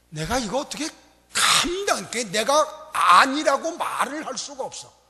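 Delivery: usual speaking voice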